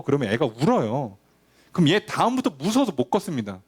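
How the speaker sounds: ordinary talk, nothing marked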